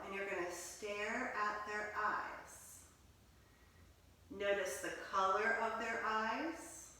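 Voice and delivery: soft, hushed meditation voice; guided meditation. Acted. Teacher guiding children into quiet listening, hushed. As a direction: settle the children into stillness.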